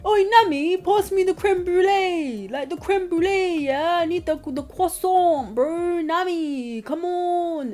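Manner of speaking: imitating a Cockney chef demanding dessert; loud, high mock Cockney bossing Nami around; mock Cockney accent. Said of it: "Wish's accent was French".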